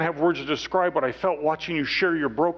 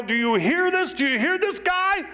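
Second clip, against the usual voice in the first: much higher and louder, ringing out to the whole room; joyful yell; 3 dB louder than usual. Retold or imitated. Mark wanting to shout to the room, triumphant loud yell. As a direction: proclaim it to everyone, triumphant. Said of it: "I want to stand up in the room and yell".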